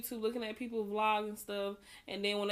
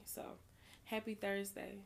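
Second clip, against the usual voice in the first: usual speaking voice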